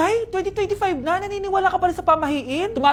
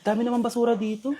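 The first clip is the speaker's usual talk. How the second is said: normal talking voice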